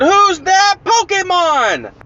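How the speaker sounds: high, drawn-out cartoon announcer call. TV show calls out to the viewers, high announcer imitation. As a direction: stretch it out like a TV quiz jingle. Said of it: "I heard on the T V it said".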